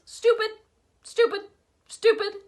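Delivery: high squawking parrot repeats; bird voice. A narrator's reading. Bird calling from its cage, high parrot squawk. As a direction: squawk "Stupid!" three times. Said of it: "he called".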